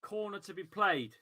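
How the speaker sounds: ordinary delivery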